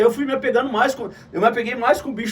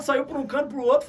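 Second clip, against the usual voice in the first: ordinary talk, nothing marked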